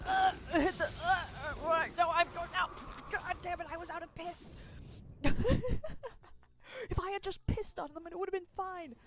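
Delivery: high, fast panicked chatter; frantic gamer. Real, not acted. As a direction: scramble in the middle of a fight. Frantic in-game moment.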